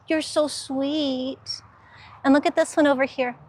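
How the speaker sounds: normal talking voice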